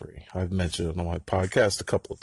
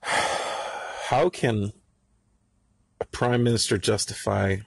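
normal talking voice

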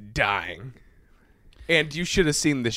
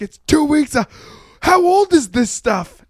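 high, loud disbelief; mock outraged yell; 13 semitones higher, 5 dB louder than usual. Retelling an argument over old food, mock outraged yell. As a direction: imitate an appalled kitchen inspection.

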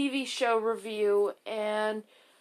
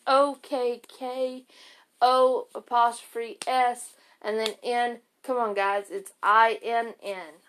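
spelling it out. Shown as a demonstration. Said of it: "so I'm gonna spell it for you guys".

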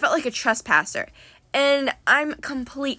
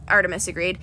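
ordinary delivery

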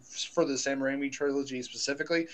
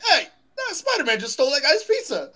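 high, fast, louder mock call-out; bystander shout; 10 semitones higher, 6 dB louder, faster than usual. A bystander calling out Spider-Man's pizza theft, high mock shout. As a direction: tattle loudly like a bystander. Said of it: "that guy's like".

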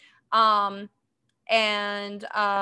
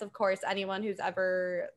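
usual speaking voice

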